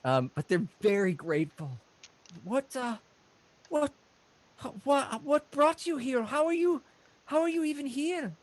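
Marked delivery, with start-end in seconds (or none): 2.4-8.3 high, halting voice; puzzled halfling. Acted. Halfling host asking rescuers why they came, amazed. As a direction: stammer questions in amazement.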